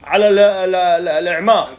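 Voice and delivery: calling out loudly. Real, not acted. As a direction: raise your voice over the noise. Shouting over noise.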